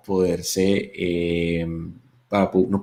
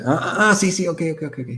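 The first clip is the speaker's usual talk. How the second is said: mock dawning realization, pitched up; sudden understanding; 8 semitones higher than usual. Acts out woman suddenly understanding.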